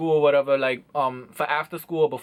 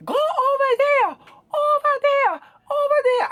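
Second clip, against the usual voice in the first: hammed-up, piercingly high shooing; imitated angry shopkeeper; 4 dB louder, slower than usual. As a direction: mimic her with high, stretched, repeated cries. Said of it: "Let me show you. This is exactly how she looked".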